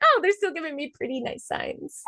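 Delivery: high, light little quote; mock relief. Woman reacts to still-nice signs, high mock relief.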